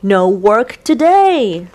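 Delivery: loud, happy chant; excited sailors. A narrator's reading. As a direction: call it out with joy. Sailors cheer the day off.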